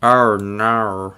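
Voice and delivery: put-on Russian accent, loud dismay; mock Russian accent. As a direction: lament it in a hammed-up Russian accent. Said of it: "devolved into, like, a Russian accent".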